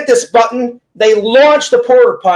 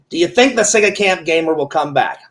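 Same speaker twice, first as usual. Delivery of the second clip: ordinary delivery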